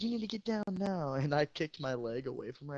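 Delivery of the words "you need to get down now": high, put-on nagging teacher voice; mock chemistry teacher. Student imitating chemistry teacher grabbing him, high mocking. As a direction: parrot the teacher's order mockingly. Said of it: "grabbed me and said".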